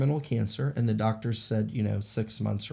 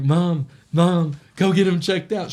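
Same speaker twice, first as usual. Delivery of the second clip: loud, higher retold plea to mom; urgent call; 6 semitones higher, 8 dB louder, slower than usual.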